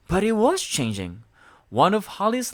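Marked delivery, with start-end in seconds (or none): none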